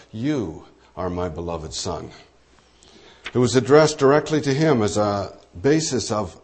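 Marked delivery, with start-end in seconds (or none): 0.1-2.1 much softer and lower, spoken close; intimate voice of God. Acted. The Father assuring Jesus directly, soft and low. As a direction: say it quietly, straight to the son.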